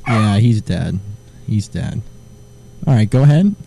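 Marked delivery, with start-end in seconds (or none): none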